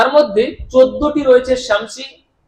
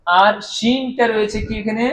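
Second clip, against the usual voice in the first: ordinary talk, nothing marked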